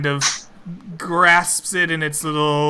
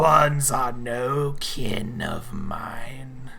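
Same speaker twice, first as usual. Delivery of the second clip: slow, whispered beast voice; creature voice; 2 semitones lower, much slower than usual. Acted. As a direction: rasp it out slowly like a predator. GM voices giant mink.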